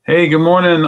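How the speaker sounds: loud, bright hello to a viewer; cheerful greeting. Real, not acted. Greeting Ron on the stream, hearty and loud. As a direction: call out a hearty good morning.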